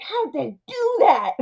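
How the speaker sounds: high, drawn-out wonder; amazed kid. Retold or imitated. Watching the movie effect as a kid, playful amazement. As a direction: marvel at it like an astonished child. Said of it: "as a kid, I was just like".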